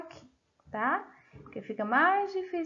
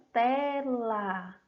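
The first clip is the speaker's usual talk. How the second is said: "tela" drawn out as te, la; slow, separated syllables on "tela". Shown as a demonstration. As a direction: stretch both syllables of "tela". Teacher sounding out word for pupils.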